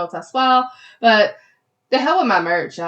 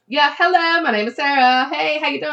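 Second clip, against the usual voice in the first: high, perky mock hello; mock introduction; 6 semitones higher, 3 dB louder than usual. Host mock-introduces herself to outsiders, exaggeratedly perky. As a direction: introduce yourself with exaggerated cheer, pitched up.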